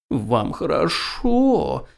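slow, drawn-out whimper; whining. Acted. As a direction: whine it like a sulking cat. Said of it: "захныкал кот".

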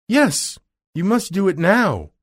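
high, urgent demand; loud opening, high emphatic order; Dorothy crying out. Acted. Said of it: "cried Dorothy".

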